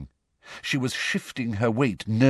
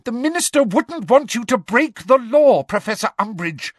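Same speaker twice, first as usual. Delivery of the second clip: high, loud frantic appeal; crying out; 14 semitones higher, 5 dB louder than usual. A narrator's reading. Hermione appeals to Professor Umbridge, crying out. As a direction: plead loudly, voice pitched very high. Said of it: "cried Hermione".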